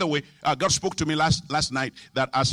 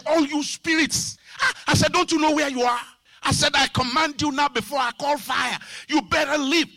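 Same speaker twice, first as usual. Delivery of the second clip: high, forceful preacher's command; exorcist's raised-pitch rebuke; commanding spirits; 9 semitones higher than usual. Retold or imitated. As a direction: order demons out.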